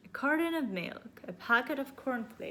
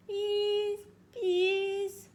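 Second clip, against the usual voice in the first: put-on excited child; 12 semitones higher, 4 dB louder than usual. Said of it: "He was very excited".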